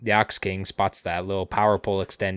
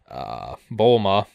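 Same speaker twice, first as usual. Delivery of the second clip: ordinary talk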